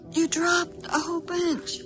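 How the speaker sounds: high, slow mock duck remark; voicing the ducks. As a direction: lift the pitch and draw it out as a duck. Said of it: "They're like".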